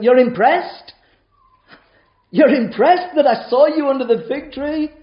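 You're impressed? rising, with weight on impressed; incredulous emphasis on impressed; stress on impressed. Shown as a demonstration. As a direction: lean hard on impressed, incredulous rise. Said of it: "If I can put the emphasis on it".

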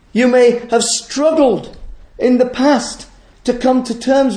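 fervent pulpit delivery; full-force preaching. Acted. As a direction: preach it out to the congregation.

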